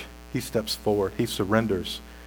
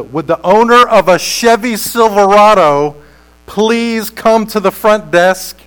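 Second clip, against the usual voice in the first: loud, higher public-address call; intercom announcement; 6 semitones higher, 7 dB louder than usual. Acted. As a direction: page a car owner over the intercom. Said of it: "over the intercom you hear somebody say".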